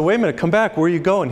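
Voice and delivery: calling after someone. Acted. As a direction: call him back as he runs off. Men calling after fleeing man.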